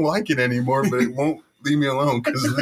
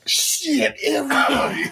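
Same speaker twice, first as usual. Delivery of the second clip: pitched-up yell; high shout; 12 semitones higher than usual. Pretend. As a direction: call out high to everyone. Calling out in bubble.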